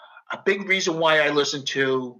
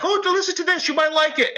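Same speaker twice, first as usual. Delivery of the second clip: much higher cheerful mother voice; imitating his mother; 13 semitones higher, faster than usual. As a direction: mimic mom eagerly sharing a song. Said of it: "my mother would be like".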